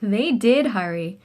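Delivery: plain reading voice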